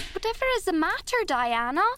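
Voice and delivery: high, fast burst of alarm; excited cry. A narrator's reading. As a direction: blurt it out in startled alarm. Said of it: "cried Anne".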